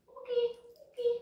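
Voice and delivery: high, bright okay to free the dog; high release cue. Real, not acted. Trainer releasing her puppy from the stay, high chirpy okay.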